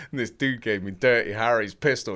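ordinary talk

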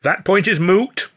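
slow, high, loud pronouncement; self-important and lofty; pompous. A narrator's reading. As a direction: pronounce it grandly, drawing the words out. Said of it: "Hurley said pompously".

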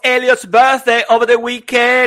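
high, loud birthday hype; rally everyone loudly to celebrate; excited announcement. Real, not acted. Announcing co-host's birthday, rallying singing.

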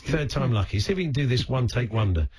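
normal talking voice

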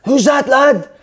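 high, loud, slow shouted heckle; retold shouting. Tommy heckling during the live broadcast, loud high shout. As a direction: bellow it to put him off. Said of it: "he starts shouting at Mark Benstead".

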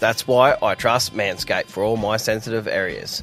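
upbeat sponsor read, lightly sold. Acted. Host reading the Manscaped ad to listeners, upbeat ad read. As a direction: sell the trimmer like a radio ad.

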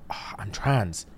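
low, soft, sighed admission; flustered confession, imitated. Bride confessing to her husband, imitated quiet fluster. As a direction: sigh it out softly, imitating her. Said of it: "starts crying, getting flustered".

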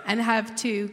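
laughing through the words; bashful laugh, then the line. Real, not acted. Laughs mid-introduction.

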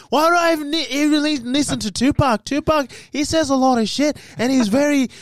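high-pitched rambling voice, run-on and aimless; Gerard impression. Friend mimicking Gerard barging into the R&B talk, high rambling impression. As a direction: prattle on high in pitch, going nowhere. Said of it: "then fucking Gerard just starts coming in".

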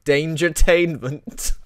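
drawn-out hype announcer. Pretend. Mocking the show's title.

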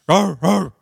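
two loud imitated barks; punchy double woof; dog barking. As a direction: bark twice like a dog. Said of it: "and the dog says".

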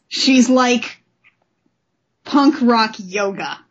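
usual speaking voice

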